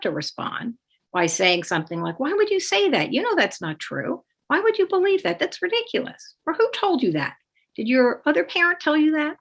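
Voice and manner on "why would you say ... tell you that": high, indignant defensive parent. Retold or imitated. Accused parent snapping back at child, high indignant protest. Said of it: "the targeted parent is likely to respond".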